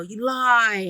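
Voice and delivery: imitating an angry shouted charge; angry accusation. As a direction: hurl the accusation back, mock furious. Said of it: "And he was that angry".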